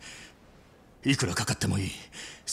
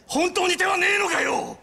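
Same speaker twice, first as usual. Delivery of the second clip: begging shout to the doctor; desperate shout; 11 semitones higher than usual. Acted. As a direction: cry out at the doctor for a way. Desperate brother begs doctor.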